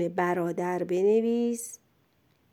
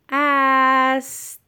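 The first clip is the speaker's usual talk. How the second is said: است stretched long, higher and louder; slow dictation; 4 semitones higher, 5 dB louder, much slower than usual. Shown as a demonstration. Teacher dictates the last word to a pupil, loud and drawn-out. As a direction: draw out the vowel of است.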